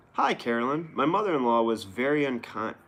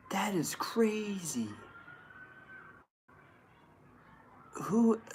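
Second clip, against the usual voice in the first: mock whisper; 10 semitones higher, slower than usual. Sarcastic hushed disbelief.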